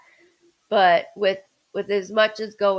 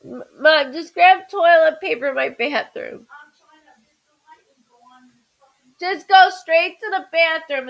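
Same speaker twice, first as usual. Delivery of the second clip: louder and higher, calling across the house; 4 semitones higher, 6 dB louder than usual. Real, not acted. Calling to mom to fetch toilet paper, raised voice. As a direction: call to another room, pitched up and louder.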